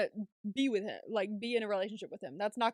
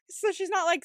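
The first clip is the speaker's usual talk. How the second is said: ordinary talk, nothing marked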